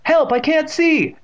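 very high, loud, slow wail; panicked scream. A narrator's reading. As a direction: scream blindly for help. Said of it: "Evan screamed".

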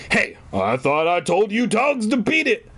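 high, irate dad outburst; scolding dad. A narrator's reading. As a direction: burst in telling the dogs off. Dad bursts in, scolding dogs.

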